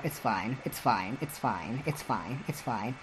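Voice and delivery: muttered softly, over and over; quiet resigned repeat. Real, not acted. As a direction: soothe yourself under your breath. Self-soothing after rambling on.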